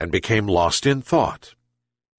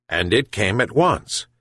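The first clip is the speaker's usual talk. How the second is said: usual speaking voice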